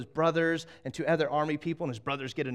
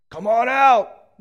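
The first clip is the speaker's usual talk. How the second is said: slow, high, booming taunt; taunting shout; 9 semitones higher, 15 dB louder, much slower than usual. Acted. Giant calls out the enemy army to fight, slow booming shout. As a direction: bellow it across the valley.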